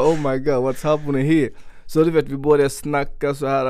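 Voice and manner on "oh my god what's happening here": panicked. Retold or imitated. Retelling panic in the interview.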